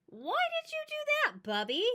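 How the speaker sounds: put-on a little girl asking her grandma, high and wondering; high child voice. Little girl asks her bubby, high child voice.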